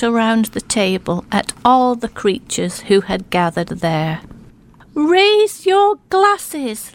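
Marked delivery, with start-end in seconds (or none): 4.9-6.9 slow, high, grand toast. Acted. Little mole toasting the guests, slow and grand. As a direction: raise the glass slowly and grandly. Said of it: "Little Mole said".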